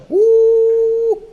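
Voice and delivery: high loud hoot; excited whoop. Real, not acted. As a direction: whoop at the comeback.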